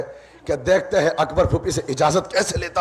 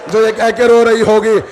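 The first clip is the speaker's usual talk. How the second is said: wailing lament; 4 semitones higher, 6 dB louder than usual. Acted. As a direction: cry it out long, like a mourning woman. Lady weeping for Hussain.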